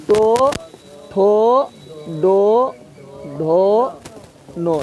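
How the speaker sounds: टो, ठो, डो, ढो, नो each said alone with a pause after it; slow syllable drill. Shown as a demonstration. Teacher reciting the retroflex row for students to repeat. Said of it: "देख यो बोल जाए".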